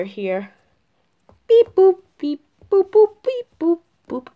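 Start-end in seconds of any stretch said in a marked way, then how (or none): 1.5-4.3 high, short electronic beeps and boops; imitated dialling beeps. Junior dials his friend on the new phone, high imitated beeps. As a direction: mimic phone keys with pitched syllables.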